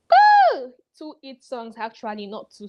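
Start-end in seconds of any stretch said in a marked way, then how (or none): 0.1-0.7 a high, loud "Ooh!"; surprised ooh. Real, not acted. Vlogger reacting to the fan quote, loud. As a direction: squeal of mock shock.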